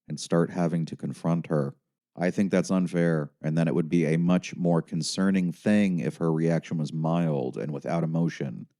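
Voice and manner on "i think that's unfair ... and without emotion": ordinary delivery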